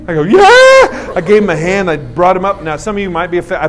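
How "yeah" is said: very high, loud "yeah"; hyped-up squeal of agreement; acted enthusiasm. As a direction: yell it as total confirmation. Acts emphatic reply to son.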